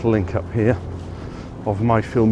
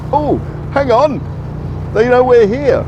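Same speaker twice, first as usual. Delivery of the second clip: much higher, squeaky silly voice; high squeaky mock voice; 14 semitones higher, 6 dB louder than usual. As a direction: squeak it out like a startled cartoon bobby. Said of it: "and they're like".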